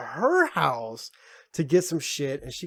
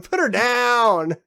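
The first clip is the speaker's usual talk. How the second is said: drawn-out loud, higher repeat; slow mock command; 7 semitones higher, 7 dB louder, much slower than usual. Drawing out the mock order about the girl. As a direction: stretch the order out, louder, like a dramatic demand.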